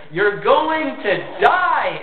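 high, stretched-out mock yell; hammed-up, slow, high-pitched warning call; insistent warning. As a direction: drag the words out as if calling across a highway. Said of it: "the guy is just insistent about it".

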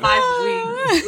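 high, loud playful jab; high teasing zinger; teasing burn. Acted. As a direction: land the roast with a laugh. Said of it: "That was my burn".

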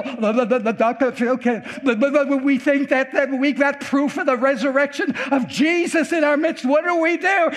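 high, stuttering panic, hammed up; flustered stammering. Hospital staff flustered by proof of resurrection, stammering mock panic.

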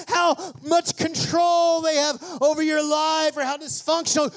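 rising from firm to shouted preaching; preaching at full force. Acted. Pastor urging the church, climbing into full shout. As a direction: build it up till you're shouting at the church.

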